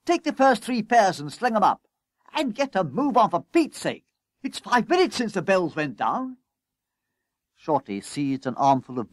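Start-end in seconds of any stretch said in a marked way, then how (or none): 0.1-6.4 high, urgent orders; harassed boss, raised pitch; calling out. A narrator's reading. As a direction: chivvy him frantically. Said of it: "called Coupling".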